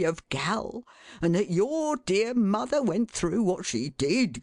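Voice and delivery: a plaintive, weary appeal; pleading father. A narrator's reading. Father pleading with his son about his sister.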